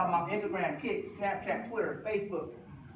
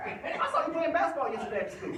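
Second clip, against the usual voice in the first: laughing retort; 8 semitones higher, 3 dB louder than usual. Retold or imitated. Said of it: "I'm like".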